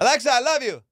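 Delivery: high, loud declaration of love; telling Alexa he loves her, high and loud; gushing lover. Retold or imitated. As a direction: proclaim it like a smitten boyfriend.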